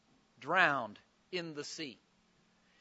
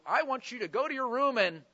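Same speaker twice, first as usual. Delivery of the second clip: louder, higher stern mom or dad; scolding parent; 5 semitones higher, 6 dB louder than usual. Acted. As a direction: send a child off to think it over. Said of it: "Did mom or dad ever say to you".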